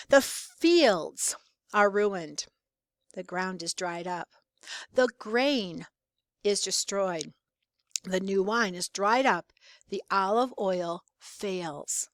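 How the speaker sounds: "fields", "grain" and "olive oil" set apart with deliberate pauses; stressed words, pauses. Shown as a demonstration. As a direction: read it as a slow list, a pause after each loss. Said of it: "So I'm pausing there".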